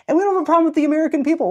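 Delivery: Canadian envoy eagerly reassuring friendly Americans; Canadian representative. Retold or imitated. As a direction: chirp it back like an eager official envoy. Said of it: "I got to be the Canadian representative, be like".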